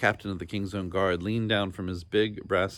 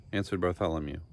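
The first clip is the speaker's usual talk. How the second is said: usual speaking voice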